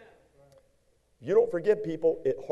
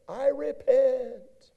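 slow pleading; 10 semitones higher, much slower than usual. Acted. Reading repentant plea.

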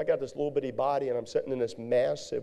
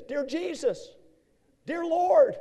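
much higher, strained cries of "Dear Jesus, dear Lord"; desperate prayer in pain; 3 dB louder, slower than usual. Retold or imitated. Preacher in agony on the way to hospital, crying out high. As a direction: cry out to God through the pain, voice pitched way up. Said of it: "All I kept saying is".

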